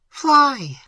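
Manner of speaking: high, loud urging; cried out. A narrator's reading. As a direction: send the maid away fast. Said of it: "cried Emily".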